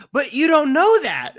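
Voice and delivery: put-on a small boy objecting; whiny boy. Father mimics his son objecting to his cousin, high and slow. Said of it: "And he's".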